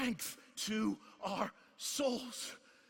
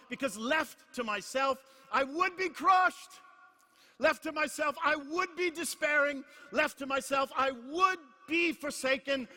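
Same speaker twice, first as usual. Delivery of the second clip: rising preaching climax; 5 semitones higher, 5 dB louder than usual. Real, not acted. Preacher building litany to climax.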